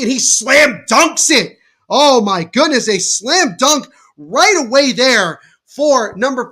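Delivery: loud burst of excitement on the dunk; thrilled play-by-play; excited call. Acted. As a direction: shout the dunk to the crowd. Slam dunk called live.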